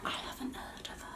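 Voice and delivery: hushed, breathy confession after a gasp; whispered aside. Real, not acted. To viewers, admitting she doesn't know the artist, whispered. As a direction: drop to a secret whisper.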